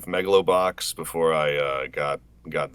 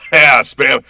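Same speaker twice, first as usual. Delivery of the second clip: ordinary delivery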